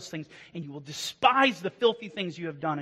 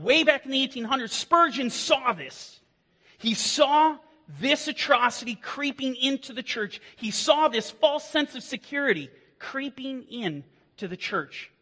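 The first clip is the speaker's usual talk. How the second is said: fiery preaching; 7 semitones higher than usual. Acted. Pastor warning church about false shepherds, fiery preaching. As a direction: preach it hard to the pews.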